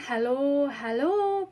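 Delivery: ordinary talk, nothing marked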